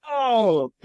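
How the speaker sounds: high, falling mock "oh"; acted startled realization. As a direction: imitate a buddy's awkward discovery. Tommy looks up, acted shock.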